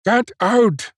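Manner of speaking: high-pitched, drawn-out stern command; stern order. A narrator's reading. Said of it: "pointed sternly to the door".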